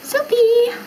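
high, bright-eyed selfie call; cute selfie squeal. Pretend. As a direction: chirp it like posing for the camera. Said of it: "I'm like".

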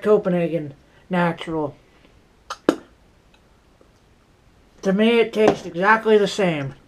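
talking around tobacco pinch; slightly thick lip speech. Real, not acted. Seen 1: Puts pinch in lip at -4, spits into cup 5-8.

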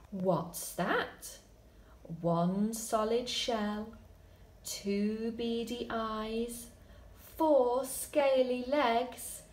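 slow build, whispered tiptoe, high panicked escape; dramatic story reading. Shown as a demonstration. Teacher reading the longer ending, hushed then frantic. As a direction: read it like a scary bedtime adventure.

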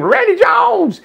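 high-pitched mock scream of a name; imitated delighted shriek, not full volume; retold scream. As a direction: squeal it out like an excited greeting. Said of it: "she screamed".

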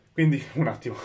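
panting with excitement; short of breath, catching air. Real, not acted. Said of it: "Scusate il fiatone".

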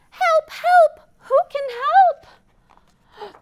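high, loud little duck crying help; duck calling for help. Acted. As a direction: squeak out a panicky call for help.

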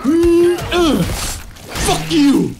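high stammer bursting into curses; real startled, high-pitched outburst; panicked cursing. Real, not acted. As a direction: stammer in fright, then swear at it. Attacked in game, curses.

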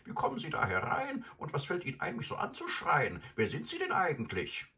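put-on high-pitched, affronted old lady; indignant grandmother. A narrator's reading. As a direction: scold the intruder in a high voice. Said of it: "rief sie entrüstet".

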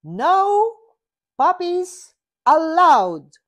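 high loud shout. A narrator's reading. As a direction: yell the rule at him. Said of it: "shouts Kitty".

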